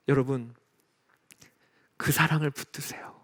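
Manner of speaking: whispered appeal. Real, not acted. Preacher's closing appeal.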